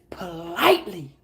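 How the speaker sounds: usual speaking voice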